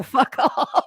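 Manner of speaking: words breaking into a laugh; real laughter at the story's end. Real, not acted. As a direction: laugh through the punchline. Laughs while finishing story.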